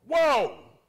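sudden loud high cry of wonder; a big shout of sudden discovery; amazed whoa. Retold or imitated. Preacher acting out reading scripture, loud amazed whoa. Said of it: "and you go".

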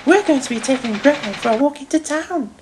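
high-pitched lady's voice; reassuring Lady Hat. A narrator's reading. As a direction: explain kindly in a high female voice. Lady Hat's quote continues.

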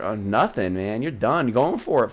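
ordinary delivery

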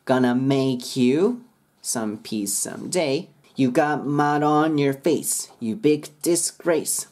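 slow rhythmic "Gonna make you some peace"; linked lyric model. Shown as a demonstration. Teacher finishing the verse, slow and linked. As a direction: stretch "mud on your face", words run together. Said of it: "綺麗に歌えます".

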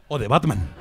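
very low, dramatic superhero voice; hammed-up low punchline; mock-menacing reveal. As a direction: drop deep for a Batman-style reveal. Mock-menacing Batman reveal to stranger.

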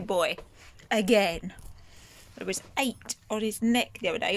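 ordinary delivery

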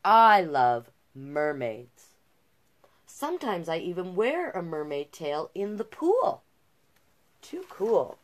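storybook read-aloud, a little slow on the first line; picture book narration. A narrator's reading. Reader voices Jazz loving mermaids, storybook narration. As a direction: read it to children.

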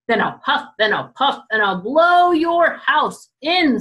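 booming big bad wolf threat.

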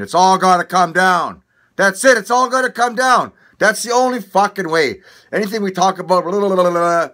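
loud, high ranting; fired-up shouting rant; heated rant. Real, not acted. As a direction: get worked up and shout it out. Ranting that it all comes down.

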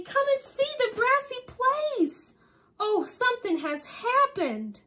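high, slow eager pleading; wide-eyed child urging Papa; small boy excited by a discovery. Acted. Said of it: "called Silbert Civet excitedly".

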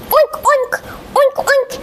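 high imitated oinking; joyful pig oinks. Pig rolls joyfully, high oinking. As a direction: oink high, in quick pairs. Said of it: "Penny the pig roared joyfully".

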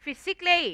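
"physically" as fi-sik-lee, high and crisp in three syllables; correct pronunciation. Shown as a demonstration. Teacher repeating the model for students, "physically" high, as fi-sik-lee. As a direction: pitch "physically" up, without the "-al-". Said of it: "อ่านว่า physically".

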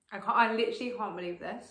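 usual speaking voice

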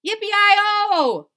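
high, loud drawn-out whoop; cowboy shout. Acted. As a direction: holler like a triumphant cowboy. Cowboy's cry heard in story.

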